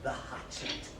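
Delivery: low whispered word; whispered eerie word. Acted. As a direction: breathe it out eerily. Odd whispered voice.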